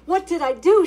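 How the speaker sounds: loud, high panicked cry; frightened cry. Acted. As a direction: cry out like a cornered girl. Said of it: "she cried out".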